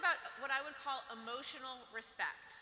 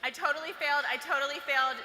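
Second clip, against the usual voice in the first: put-on worried girl; 8 dB louder than usual. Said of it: "that's what the girl's saying".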